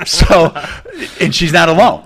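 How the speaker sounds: loud and high, words through laughter; laughing while talking. Real, not acted. Still laughing, teasing the guest about his wife.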